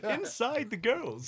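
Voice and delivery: slow words through a laugh. Real, not acted. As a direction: giggle out a crude joke. Joking, laughing through line.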